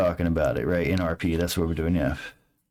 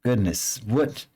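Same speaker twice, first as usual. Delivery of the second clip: higher, fussy scolding voice; higher, fussy rebuke; scolding NPC; 9 semitones higher than usual. Acted. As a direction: chide them for sneaking up. NPC scolding sneaking player.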